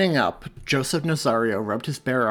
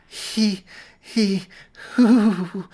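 much higher, louder straining breaths; strained breathing; 11 semitones higher, 9 dB louder, much slower than usual. A narrator's reading. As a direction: force the breaths through pain. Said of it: "purposefully breathe".

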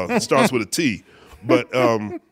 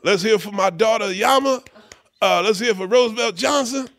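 high-pitched imitated stage announcer; mock show roll call; 7 semitones higher than usual. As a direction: call out the performers like a bandleader. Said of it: "[name] is o-, on stage. He says".

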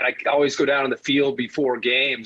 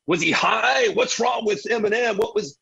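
higher-pitched mock disbelief; bewildered public; 6 semitones higher than usual. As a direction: act out baffled viewers, pitched up. Imitating bewildered public reaction.

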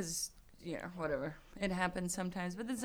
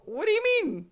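high, loud baffled question; confused high voice; 12 semitones higher, 10 dB louder than usual. Retold or imitated. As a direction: sound baffled. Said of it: "I go".

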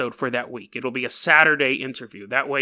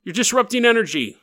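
mock cop order; 7 semitones higher than usual. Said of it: "Cops are like".

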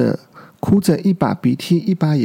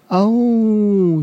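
high, long 'ao-woo' howl; young dinosaur's battle howl; charging cry; 6 semitones higher than usual. A narrator's reading. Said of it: "小梁叫着". (小恐龙高声嗷呜叫)

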